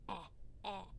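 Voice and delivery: eh pushed from the back of the throat, soft; throaty eh. Shown as a demonstration. Teacher showing where E sits in the mouth. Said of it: "E is in the back of your mouth, kinda by your throat".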